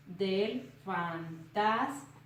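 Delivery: "del fantasma justo" very slow, each syllable held apart. Shown as a demonstration. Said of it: "se separa igual a como se dice".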